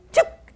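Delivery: sharp loud strike noise; snake strike sound. Retold or imitated. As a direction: snap it out like a sudden bite. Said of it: "that snake went".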